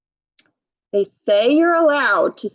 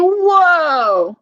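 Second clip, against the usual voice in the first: high, loud amazed whoa; boy's whoa; 6 semitones higher, 4 dB louder than usual. Acted. Reading the boy's amazed reaction aloud to kids. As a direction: gasp it out in wonder.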